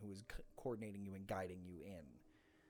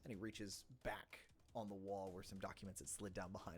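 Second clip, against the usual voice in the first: ordinary talk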